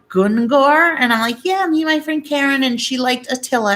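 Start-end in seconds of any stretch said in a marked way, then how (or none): 0.1-1.0 high, louder disbelieving Gungor?; incredulous coworkers. Retold or imitated. Coworkers hearing the strange name, disbelieving. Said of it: "and they're like". 1.4-3.8 giddy explaining. Retold or imitated. Explaining to coworkers.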